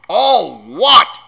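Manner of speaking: booming giant's outrage; thundering. A narrator's reading. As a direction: roar it back in disbelief. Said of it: "Hagrid thundered".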